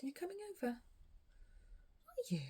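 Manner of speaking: high coaxing voice to a cat; pet talk voice. Real, not acted.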